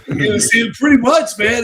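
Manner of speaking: usual speaking voice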